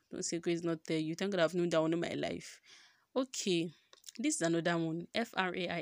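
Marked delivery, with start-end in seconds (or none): none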